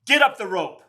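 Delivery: gym teacher's order. Retold or imitated. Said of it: "he goes".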